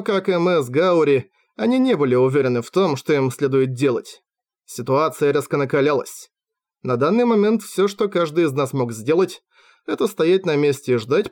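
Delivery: ordinary delivery, nothing marked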